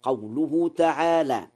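ordinary delivery

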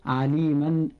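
عليما ending in "-an"; tanween ending. Shown as a demonstration. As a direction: pronounce عليما as "aliman", sounding the final n. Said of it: "عند النطق فنضيف هذه النون". (نطق عليما بتنوين الفتح)

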